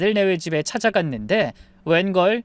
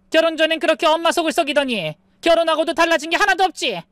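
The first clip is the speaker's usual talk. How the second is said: scolding mother; 9 semitones higher than usual. Acted. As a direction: tear into her son, outraged. 엄마가 아들을 꾸짖음. (점점 격해지는 엄마의 꾸중)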